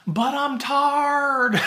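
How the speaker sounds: wife complaining from the car, hammed-up slow whine; whiny tired wife.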